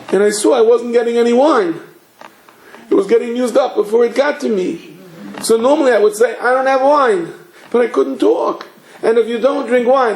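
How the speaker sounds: put-on high, whiny little-boy voice; imitated crying child. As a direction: mimic a tearful child pleading his case. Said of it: "he's like crying".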